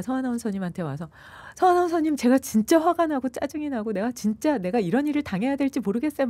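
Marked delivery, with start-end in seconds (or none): 1.6-6.3 high, loud, tearful mock complaint; wailing complaint. Complaining to the announcer, high and loud in mock tears. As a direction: whine it out like someone venting in tears. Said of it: "막 울고불고 하면서 막".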